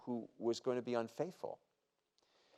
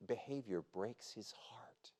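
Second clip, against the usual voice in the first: slow, much softer, near whisper; hushed sorrow; 9 dB quieter, slower than usual. Real, not acted. Preacher telling of God's broken heart, hushed and slow. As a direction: let it sink to a slow hush.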